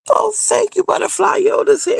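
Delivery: high, thrilled thank you; thrilled thanks to a new Patreon member; delighted squeal. Real, not acted. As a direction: gush over the new supporter.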